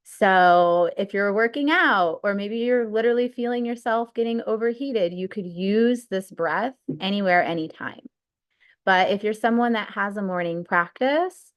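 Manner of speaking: usual speaking voice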